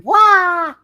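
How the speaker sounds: big cartoon landing yell; loud high "wah". Voicing Spidey's landing.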